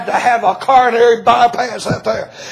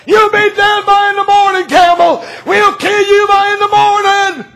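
threatening yell; 10 semitones higher, 3 dB louder than usual. Retold or imitated. Threatening mob yelling at preacher.

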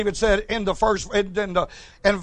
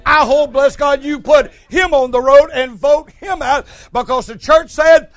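fired-up preaching; 2 semitones higher, 7 dB louder than usual. Real, not acted. Preacher rebukes church over bringing them back, fired-up shout. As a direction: thunder it out to the congregation. Preacher berates pastor who might compromise.

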